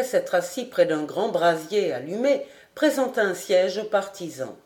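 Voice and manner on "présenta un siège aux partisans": ordinary delivery, nothing marked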